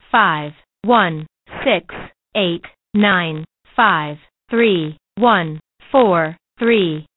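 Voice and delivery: slow number reading. Acted. Reads out phone number.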